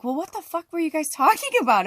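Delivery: high, loud mock-outraged demand; indignant retort. Confronting the guys about their talk, indignant. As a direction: retell it as a laughing, indignant challenge. Retelling her confrontation with strangers.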